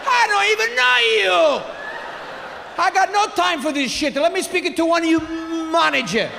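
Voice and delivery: high mock yelling; outraged father. Father demands the manager, high comic outrage. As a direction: imitate a father losing his patience.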